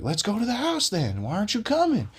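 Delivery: high-pitched imitation of a ranting man; mock screaming. Crackhead daring the man to come along, high mock scream. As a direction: yelp it high, voice held back from full volume. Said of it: "this dude's in his boxers screaming".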